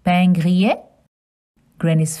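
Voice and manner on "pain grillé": pain grillé, nasal pain, gree-yay, rising; French pronunciation. Shown as a demonstration. Asking children to repeat pain grillé. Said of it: "Can you say pain grillé?".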